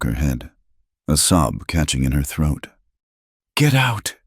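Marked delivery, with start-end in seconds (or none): none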